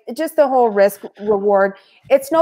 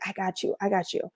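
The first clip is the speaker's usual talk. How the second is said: softer, a conspiratorial murmur; hushed promise; 12 dB quieter, faster than usual. Retold or imitated. Tony quietly promising to cover for Sarah, hushed. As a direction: promise it under your breath.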